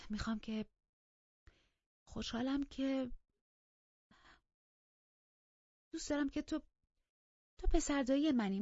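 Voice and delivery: high, halting, hesitant overture; tentative plea. A narrator's reading. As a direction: plead haltingly with long pauses, pitched high. Tentative plea to her cousin.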